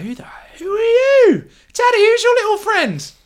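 high, mock-sweet squeaky voice building to a loud cry. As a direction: ask it in a piping, rising voice. GM voices two NPCs meeting.

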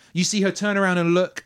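ordinary talk, nothing marked

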